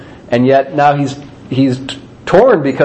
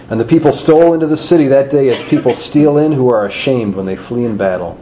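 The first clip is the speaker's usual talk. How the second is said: ordinary delivery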